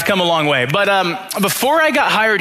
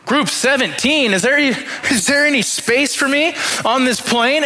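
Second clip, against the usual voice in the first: mock dismay; 8 semitones higher than usual. Pastor retells seeing his boarding group.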